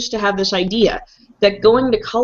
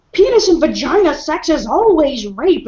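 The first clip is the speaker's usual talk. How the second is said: mocking radical feminist voice; 7 semitones higher, 3 dB louder than usual. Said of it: "there'd be one person be like".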